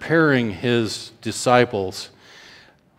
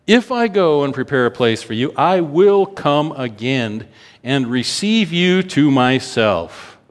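raised, slowing scripture reading; preaching scripture; 4 semitones higher, 4 dB louder than usual. Acted. Preacher proclaiming Jesus's words to the congregation, raised.

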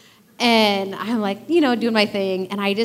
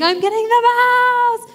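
much higher, drawn-out gleeful cry; excited daddy; 6 dB louder, slower than usual. Retold or imitated. God voiced as an excited Christmas-morning dad. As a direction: squeal like a dad with a big surprise. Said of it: "like an excited daddy on Christmas morning".

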